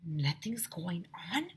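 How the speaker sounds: slow, soft whispered question; boy whispering, puzzled. Acted. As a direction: whisper it in disbelief. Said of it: "whispered Mi-, Ryan".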